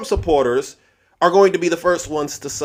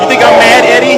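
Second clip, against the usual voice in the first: shouted at Eddie, much higher and louder; angry shout; 8 semitones higher, 10 dB louder than usual. Real, not acted. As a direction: yell the taunt at him full force.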